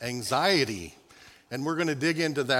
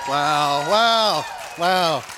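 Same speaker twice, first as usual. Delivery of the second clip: mock cheering; 8 dB louder, much slower than usual. Mock celebration after ball drop.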